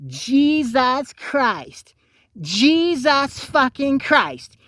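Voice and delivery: high, very slow, drawn-out mock exasperation; exasperated exclaiming. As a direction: stretch each word like you're fed up. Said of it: "people saying".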